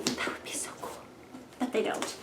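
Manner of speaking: hushed confidential joke; whispered aside. Real, not acted. A joking aside to viewers about sponsors, whispered. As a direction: whisper it to the camera.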